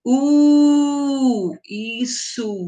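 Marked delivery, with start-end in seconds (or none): 0.0-1.5 high "U" with the lips tight and round; vowel U. Shown as a demonstration. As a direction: say "U" again with a small round mouth. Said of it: "Fale o som".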